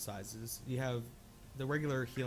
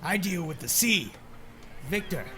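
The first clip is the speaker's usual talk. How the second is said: put-on gruff old fisherman; 4 semitones higher, 5 dB louder than usual. Sailor NPC speaks.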